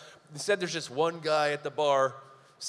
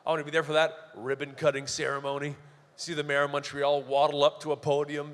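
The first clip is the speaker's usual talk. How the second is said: ordinary delivery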